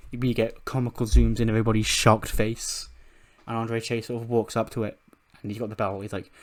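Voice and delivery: ordinary delivery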